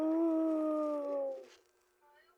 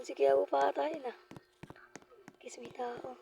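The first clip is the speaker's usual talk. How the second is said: whispering; 4 semitones lower than usual. Real, not acted. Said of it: "sneaking prank vlog".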